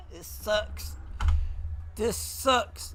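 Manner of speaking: high-pitched whiny groan; high whiny complaint. Real, not acted. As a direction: whine it up high, half laughing. After the crash.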